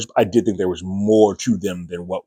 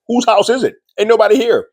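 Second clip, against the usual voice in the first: very high, incredulous brush-off; exasperated Carol; 4 dB louder than usual. Retold or imitated. Carol waves off Manusos asking whose house, very high and exasperated. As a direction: squeal it like the question is absurd. Carol dismisses the neighbor question.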